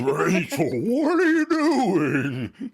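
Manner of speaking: high, slow, drawn-out wail; mock exclamation. Exclaiming at Rachel hitting the horse, drawn-out mock dismay. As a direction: stretch it into a high cartoon cry. Host cries out at Rachel's collision.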